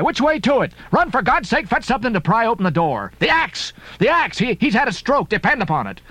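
high, urgent alarm; urgent cry. Acted. Ishmael urging the maid to fetch the axe, high urgent cry. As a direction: push it out in a rush of panic. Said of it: "cried I".

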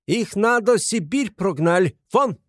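put-on high, slow foreign-accented yell; shrieking. A narrator's reading. Landlady Amalia ordering the thieves out, shrieking. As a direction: wail it in broken Russian. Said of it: "завопила Амалия Ивановна".

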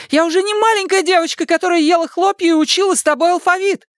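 high-pitched petulant argument; indignant protest. A narrator's reading. Daughter insists to father she is grown, indignant.

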